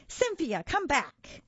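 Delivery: put-on high toddler demand; high-pitched, bossy toddler voice; demanding little girl. A narrator's reading. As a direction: call out insistently as a small girl. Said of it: "Emma demanded".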